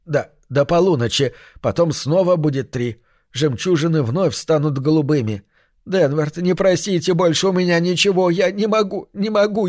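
high, trembling plea; frightened pleading. A narrator's reading. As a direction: beg him, voice rising in fear. Смит дрожал всем телом.

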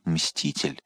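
low, very soft dying murmur; whispering. A narrator's reading. The shot lord falling into Athos's arms, whispering. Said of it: "прошептав".

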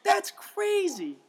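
mock surprise. Fakes surprise to the teacher.

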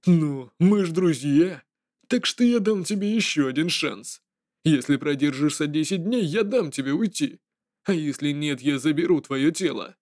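a trickster ghost toying with prey; menacing jester voice. Acted. Jester offers a deal.

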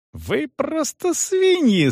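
very high, drawn-out insult; furious yell. A narrator's reading. Young man insults the officials, furious. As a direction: stretch the insult out in rage. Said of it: "снова заорал Гирш".

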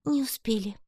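high, mournful murmur; sorrowful whisper. A narrator's reading. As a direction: lament it in a high voice. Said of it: "горестно прошептал на ухо Гишка".

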